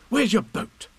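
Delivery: put-on high eager boy's voice; a young boy pleading with Owl; urgent Christopher Robin. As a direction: rush to the rescue like a small boy.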